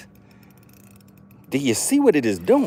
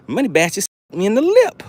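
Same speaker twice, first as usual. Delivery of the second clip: usual speaking voice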